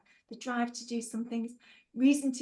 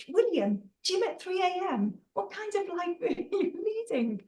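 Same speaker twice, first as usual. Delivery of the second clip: higher-pitched, playful incredulity; mock disbelief; 7 semitones higher, 4 dB louder than usual. Real, not acted. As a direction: react as if that hour is absurd. Mock disbelief at early gym time.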